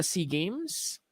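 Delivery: ordinary talk, nothing marked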